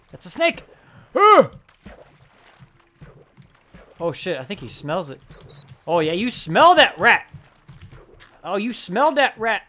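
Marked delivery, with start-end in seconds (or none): 0.1-0.6 much higher, rising exclaim; excited shout of discovery; excited realization. Real, not acted. Spots a snake, excited. 1.1-1.5 loud high cry of shock; sudden scared shriek; startled yelp. Real, not acted. Startled by the snake. 5.9-9.6 loud teasing encouragement; cheering on a pet; gleeful cheering. Real, not acted. Egging on his pet.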